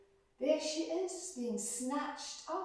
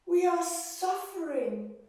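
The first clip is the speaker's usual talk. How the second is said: loud, high pleading cry to the gods; desperate prayer; 9 semitones higher, 9 dB louder, slower than usual. Acted. Suffering people crying loudly to the gods for help. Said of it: "they prayed to the gods".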